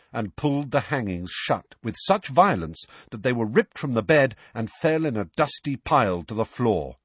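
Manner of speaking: plain reading voice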